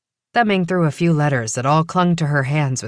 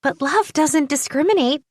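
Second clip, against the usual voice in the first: put-on lightly higher eager young woman; 11 semitones higher than usual. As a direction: voice her as a curious young woman. Primrose's voice.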